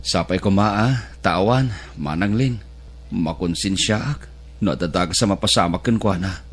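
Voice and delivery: put-on a quiet, remorseful answer to her older sister; guilty and sad. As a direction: speak low with heavy conscience. Guilt over her siblings' troubles.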